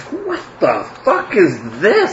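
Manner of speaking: a shopkeeper staring at a weird blade; baffled merchant. Acted. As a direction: merchant baffled by the cleaver, laughing slowly. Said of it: "He looks at this and goes".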